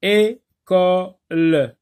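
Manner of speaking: "École" drawn out slowly as é, co, le; split into three syllables. Shown as a demonstration. Teacher counting syllables for pupils, split into three syllables. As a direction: say "École" in three separate loud beats. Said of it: "trois syllabes".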